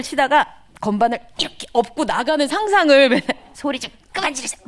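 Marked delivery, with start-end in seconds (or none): 3.5-4.6 imagined retort. Retold or imitated. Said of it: "이렇게 상상을 하면서".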